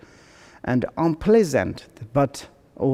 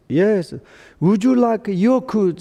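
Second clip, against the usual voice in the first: high, raised acted voice; village conversation; 7 semitones higher than usual. Villager offering yogurt to an old guy, high raised voice. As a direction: offer it to an old man, pitched up. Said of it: "there is one old guy. One person says".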